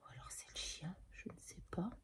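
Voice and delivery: hushed unvoiced whisper. Real, not acted. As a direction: whisper it, listening for a sound. Se demande qui frappe. (chuchoté)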